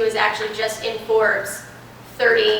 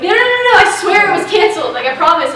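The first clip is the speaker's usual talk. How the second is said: much higher and louder, rushed protest; frantic pleading; 8 semitones higher, 9 dB louder than usual. Retold or imitated. Candidate on the phone to the team, frantic high pleading. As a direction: beg them to believe you. Pleading flight was really cancelled.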